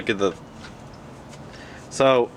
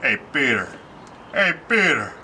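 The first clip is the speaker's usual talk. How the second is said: Joe impression; 2 semitones higher than usual. Shown as a demonstration. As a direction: call to the neighbour in a put-on cartoon voice. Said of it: "Joe from Family Guy".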